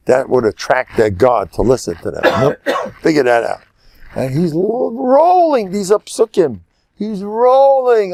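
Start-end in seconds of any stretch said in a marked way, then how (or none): 4.2-8.1 high and slow, savoring each word; relishing delight. Real, not acted. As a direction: draw it out with glee. Relishing Eliyahu rolling the verses.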